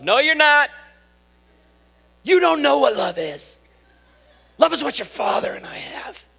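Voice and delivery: high, loud, dismissive mother voice; mocking mom. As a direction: scoff like a know-it-all mom. Mom voiced scolding teen daughter.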